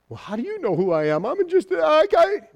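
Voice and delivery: high, loud whiny protest of a nobody; doubtful man. Acted. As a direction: play the small guy who can't believe it. Doubting man answers God.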